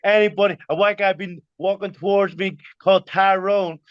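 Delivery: high-pitched imitation of an astonished American; amazed American man.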